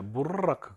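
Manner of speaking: short 무 into a rolled r in 무르라크; doubled r. Shown as a demonstration. Teacher repeating the original form, rolled r in 무르라크. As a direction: trill the r at length in 무르라크. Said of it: "원래 이론적으로 무르라크가 돼야".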